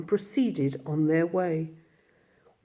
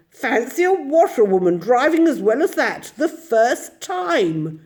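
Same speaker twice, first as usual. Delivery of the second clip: higher, louder, slowing at the end; admiring gentlemen; 7 semitones higher, 5 dB louder than usual. A narrator's reading. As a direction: marvel at her.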